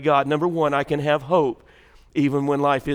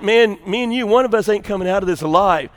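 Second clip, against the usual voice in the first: raised, higher threatening voice; retold yelling; 5 semitones higher, 6 dB louder than usual. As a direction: square off loudly against a dog. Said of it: "and so I'm yelling".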